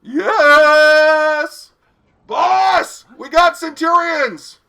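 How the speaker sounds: shouted high and loud across the train; calling out. Acted. Attendant shouting to his boss about the guests, loud calling. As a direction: yell back into the carriage for the boss.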